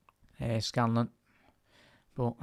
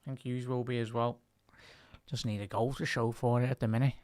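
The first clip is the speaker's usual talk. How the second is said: ordinary talk, nothing marked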